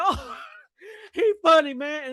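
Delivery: imitating viewers laughing. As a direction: chuckle out he funny. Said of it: "And cats be like".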